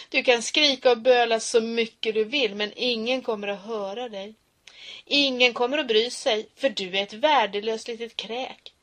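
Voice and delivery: loud contemptuous shouting; bully shouting threats; menacing bully. Acted. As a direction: crush him with every word.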